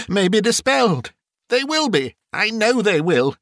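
high-pitched, fervent relief; overjoyed cry. A narrator's reading. Scrooge finds his curtains still there, overjoyed. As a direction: exclaim it in rising joy and wonder. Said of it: "cried Scrooge, folding one of his bed curtains".

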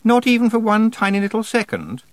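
very high, loud, cutesy teasing; would-be playful. A narrator's reading. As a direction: overdo the playful wheedling in a high voice. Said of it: "what she probably thought was a playful voice".

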